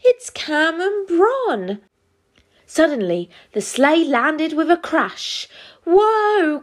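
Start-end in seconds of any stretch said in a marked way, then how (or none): 0.0-1.8 high, drawn-out amazed discovery; astonished. Acted. Elves discover the two stowaways. 5.9-6.6 high, alarmed cry. Acted. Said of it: "cried everyone".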